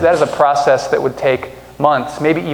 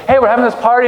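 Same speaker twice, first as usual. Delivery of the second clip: high, louder bright invitation; cheery host invite; 9 semitones higher, 4 dB louder, faster than usual. Retold or imitated. Friend inviting you to a party, high and louder. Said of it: "somebody goes".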